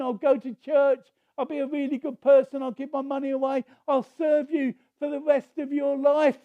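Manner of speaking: high, loud frantic bargaining; panicked promises tumbling out; desperate pleading. Retold or imitated. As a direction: beg God with every promise. Jack bargaining desperately with God.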